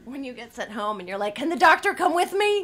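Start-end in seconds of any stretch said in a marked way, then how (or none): 1.3-2.7 high, loud, laughing mock panic; laughing mock plea. Laughing new mother begging the hospital to send the doctor home. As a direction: beg half-joking, laughing.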